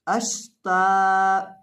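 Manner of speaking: अश ता read slowly with शीन kept still, no bounce; joined reading. Shown as a demonstration. Teacher demonstrating the correct reading of अश ता. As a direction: join अश to ता smoothly. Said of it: "इसको हमने इस तरह से पढ़ना है".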